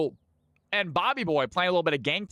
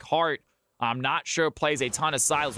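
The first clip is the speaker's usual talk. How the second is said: ordinary delivery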